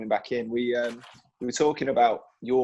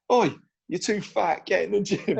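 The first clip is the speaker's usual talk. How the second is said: mock coach yell, pitched up; retold shouting; 7 semitones higher than usual. Youth coach shouting at the Italian boys, mock yell. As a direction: bark it like an angry youth coach. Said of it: "had run about shouting".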